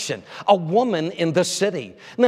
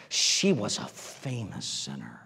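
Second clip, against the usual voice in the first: far lower and softer, slow; very low hush; 10 semitones lower, 10 dB quieter, slower than usual. Acted. Preacher naming her a famous sinner, slow and hushed. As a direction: drag it out low and quiet. Dramatic drop on key word.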